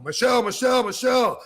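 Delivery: urgent yelled name; retold scream. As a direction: call her off sharply. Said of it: "starts screaming at her".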